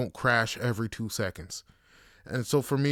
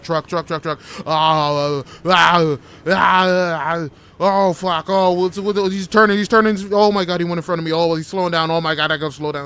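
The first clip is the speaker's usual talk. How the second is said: much louder, higher frantic panic; panicked yelling; 6 semitones higher, 12 dB louder than usual. Retold or imitated. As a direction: scream through a near crash. Reenacted highway panic.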